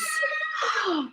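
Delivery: sharp high gasp of wonder. Acted. Reader showing kids the starry sky, a high amazed gasp. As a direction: gasp as if seeing the stars.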